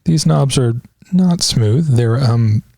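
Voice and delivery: ordinary talk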